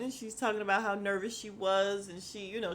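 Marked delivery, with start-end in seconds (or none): none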